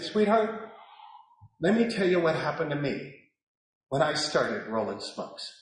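put-on soft, gentle talk to a child; hushed, kindly father's confiding; loving. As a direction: speak softly with empathy. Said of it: "I talked to her with love".

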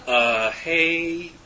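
awkward, halting message full of ums; hesitant mock voicemail, stretching out the fillers; rambling voicemail message. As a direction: re-enact a nervous, drawn-out phone request. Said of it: "I left the ten-minute long conver-, you know, message on his voicemail".

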